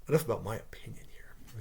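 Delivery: hushed throwaway comment; under-the-breath aside; whispered aside. Real, not acted. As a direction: whisper it to yourself. Aside, dropping his opinion.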